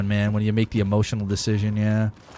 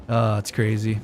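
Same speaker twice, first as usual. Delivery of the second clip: ordinary talk, nothing marked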